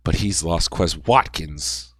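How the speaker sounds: ordinary talk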